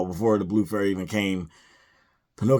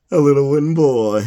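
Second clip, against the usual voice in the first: higher and much louder, a hammed-up showman; Honest John voice; 6 semitones higher, 9 dB louder than usual. Honest John spotting Pinocchio, hammed-up and loud. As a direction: boom it like a sly fox sizing up a mark. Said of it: "he's like".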